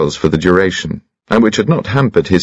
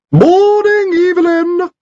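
very high, piping little voice, a bit louder; extraordinarily high-pitched; 3 dB louder, slower than usual. A narrator's reading. Visitor calls through the door to Evelyn, very high piping voice. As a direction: squeak it out like a tiny old gentleman. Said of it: "extraordinarily high-pitched voice".

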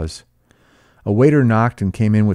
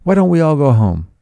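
beg, growing more insistent; helpless pleading; 3 semitones higher, 5 dB louder than usual. A narrator's reading. Daisy begs everyone to leave, helpless and pleading. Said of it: "she interrupted helplessly".

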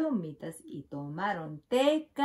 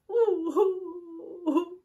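mock sobbing; 9 semitones higher, much slower than usual. A narrator's reading. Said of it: "Oso llora".

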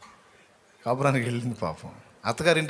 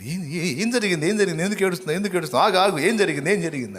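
high, loud alarmed questioning; frantic worried husband asking what happened; 8 semitones higher, 5 dB louder, faster than usual. Retold or imitated. Husband startled by his crying wife, loud alarmed questions. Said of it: "అంటున్నాడు".

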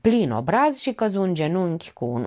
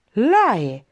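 a startled, louder blurt of the name; stammered surprise; 3 semitones higher, 5 dB louder than usual. A narrator's reading. Vet recognizing the man running toward them, stammering in surprise. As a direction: gasp the name out in disbelief. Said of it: "bâlbâi fără să-și creadă ochilor".

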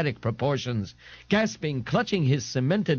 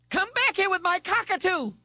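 much higher, strained call after her bird; Darlene crying out. A narrator's reading. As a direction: yell after the runaway bird in a high voice. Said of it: "Darlene cried".

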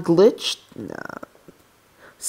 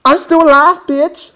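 high, loud crowing; triumphant taunt; 11 semitones higher, 7 dB louder than usual. Real, not acted. Player surviving an attack, high triumphant taunt. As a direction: crow it at the enemy.

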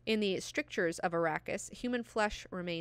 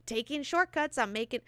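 high, loud, gloating boast; a high-pitched mock brag; mock smug rider; 11 semitones higher, 3 dB louder than usual. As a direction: play the smug scooter rider crowing about speed. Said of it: "and you're like".

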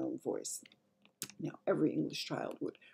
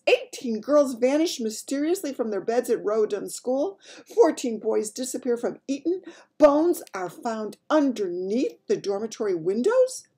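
loud, high headline reading; aghast Queen; 7 semitones higher, 7 dB louder than usual. Acted. Queen reading the newspaper to Mary, aghast. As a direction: read out each horror, aghast.

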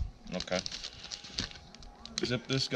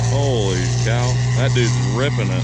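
loud; 9 dB louder than usual. Real, not acted. Loud sprayer going off.

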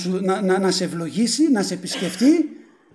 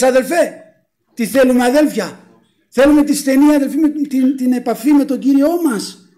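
raised, forceful call to the faithful; fervent preaching; 4 semitones higher, 7 dB louder than usual. Real, not acted. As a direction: urge the congregation insistently. Preacher exhorting the congregation. (φλογερή προτροπή από άμβωνος)

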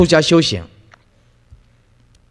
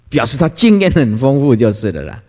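ordinary delivery

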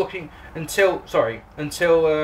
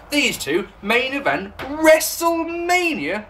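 much higher, slow rising disbelief; as if it's absurd; incredulous; 9 semitones higher, slower than usual. Real, not acted. Mocking the idea of these two headlining, high incredulous question.

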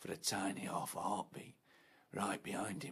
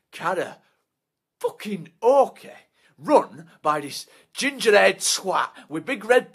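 loud, higher and slow, spitting the name; disgusted shout; 9 semitones higher, 17 dB louder than usual. Acted. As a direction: bellow it in outrage. Muttered then shouted name.

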